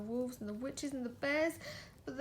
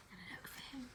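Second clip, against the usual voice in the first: barely voiced aside; breathy whisper to the listener; 15 dB quieter, much faster than usual. Real, not acted. Parent whispering to child mid-story.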